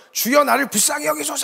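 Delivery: acted desperate yell, pitched high; crying out. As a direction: cry out to be noticed over a crowd. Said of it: "이러면서 막 소리를 질렀다 그래요".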